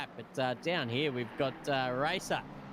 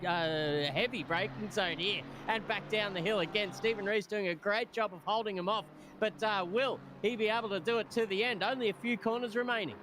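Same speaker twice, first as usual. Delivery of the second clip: higher-pitched, flowing race commentary; live play-by-play; 5 semitones higher than usual. Real, not acted. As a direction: follow the chase corner by corner.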